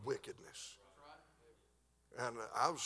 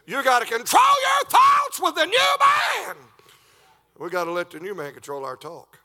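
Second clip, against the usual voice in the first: preaching shout; 6 semitones higher, 16 dB louder than usual. Acted. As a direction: let it ring out to the congregation.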